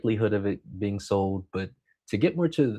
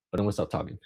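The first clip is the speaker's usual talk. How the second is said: ordinary talk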